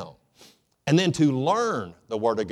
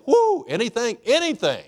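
put-on excited old man; 11 semitones higher, 4 dB louder than usual. Old man offered any wish.

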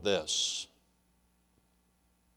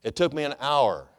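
normal talking voice

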